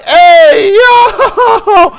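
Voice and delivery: high, loud laughing yell; startled whooping exit cry; laughing shout. Retold or imitated. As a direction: shout it as you bolt for the door, cracking up. Bolting out of the club.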